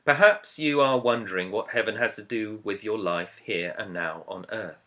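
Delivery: ordinary delivery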